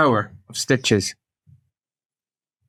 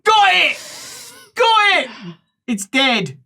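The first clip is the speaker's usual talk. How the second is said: acted triumphant call; 14 semitones higher, much slower than usual. Helper announcing the removal, acted triumphant shout. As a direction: call out success, then the verdict.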